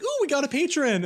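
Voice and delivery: excited squeal. Retold or imitated. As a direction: squeal with delight. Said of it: "I was like".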